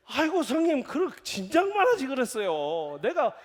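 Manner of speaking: high, loud, eager promise; eager younger brother. Retold or imitated. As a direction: rush to please the elder. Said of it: "막 이러겠죠?". (형님께 높고 크게 서두르며 약속하는 말투)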